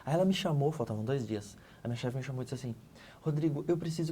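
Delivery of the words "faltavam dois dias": ordinary talk, nothing marked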